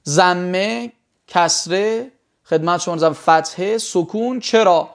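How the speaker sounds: usual speaking voice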